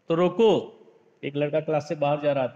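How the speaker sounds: ordinary talk, nothing marked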